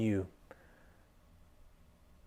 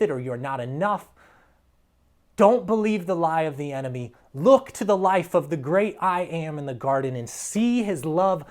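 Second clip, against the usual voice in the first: loud, rising exhortation; full-force preaching; 4 semitones higher, 6 dB louder than usual. Real, not acted. Pastor urges listeners to reject the lie, preaching loud. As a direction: drive it home to the crowd.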